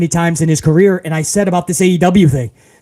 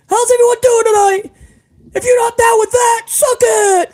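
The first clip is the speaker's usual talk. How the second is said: put-on young Triple H in DX. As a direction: squeal it like a barely-pubescent Triple H hyping the crowd. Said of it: "Remember him in D X?".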